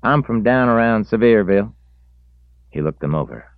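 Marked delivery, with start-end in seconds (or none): 0.0-1.7 put-on high, loud young country voice; country boy voice. A narrator's reading. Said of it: "the boy said".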